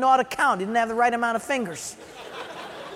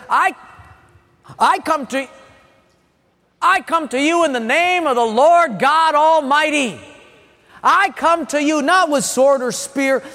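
shouted defiant reply; 2 semitones higher, 4 dB louder than usual. Acted. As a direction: proclaim it boldly at the giant. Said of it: "he shouts in reply".

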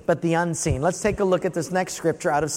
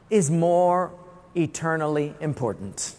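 usual speaking voice

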